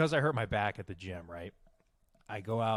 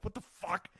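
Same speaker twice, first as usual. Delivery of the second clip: high but held-down outburst; retold exasperation; 8 semitones higher, 5 dB quieter than usual. Man overhearing while trying to stretch, retold exasperation. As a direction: fume to yourself, pitched up without shouting. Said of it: "I'm just getting all fucking angry".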